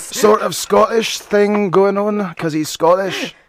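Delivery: put-on loud, high, raspy Glaswegian accent; Billy Connolly. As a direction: put on a raspy Scottish brogue, big and slow. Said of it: "like, Billy Connolly-esque, like, Glaswegian".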